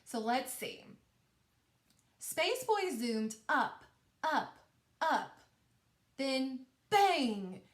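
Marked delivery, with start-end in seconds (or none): 2.2-5.3 slow, higher-pitched read-aloud with spaced-out words; storybook read-aloud, drawn out and lifted; expressive story reading. Shown as a demonstration. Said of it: "let me show you what that looks like". 6.2-7.6 "bang" punched out louder after a short lead-in; big storybook stress on "bang"; emphasis on BANG. Shown as a demonstration. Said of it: "how I really emphasized and said that word bang".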